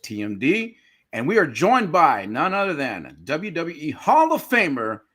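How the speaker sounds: ordinary talk, nothing marked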